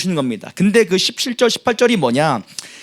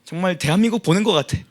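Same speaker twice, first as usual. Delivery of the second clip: usual speaking voice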